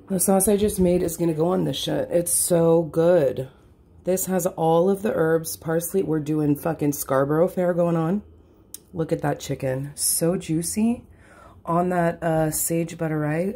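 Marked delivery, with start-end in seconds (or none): none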